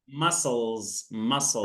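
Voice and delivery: muscles said as mus-elz, the c silent, no K; teacher's model. Shown as a demonstration. Teacher corrects student, says muscle with a silent c. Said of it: "We just say muscles".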